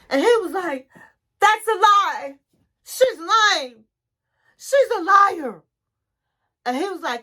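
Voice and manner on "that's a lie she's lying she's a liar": raised, louder mock protest; indignant accusation. Kelly's side protesting to the therapist, acted-out indignation. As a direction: act out an indignant denial, pitched up. Retold quarrel, partner accused of lying.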